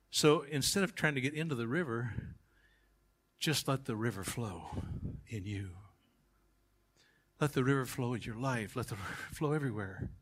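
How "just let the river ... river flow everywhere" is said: soft appeal. Acted. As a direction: let it fall to a hush. Soft after the climax.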